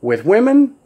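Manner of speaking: normal talking voice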